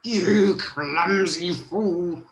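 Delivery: slow, loud, rasped-out witchy insult; drawn-out angry old-hag voice; screeching old lady. A narrator's reading. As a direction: screech it slowly like a wicked crone. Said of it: "screeched the old lady".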